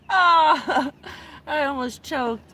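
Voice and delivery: ordinary delivery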